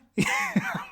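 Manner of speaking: sudden high laugh breaking out; high burst of laughter. Real, not acted. Laughs at own thought.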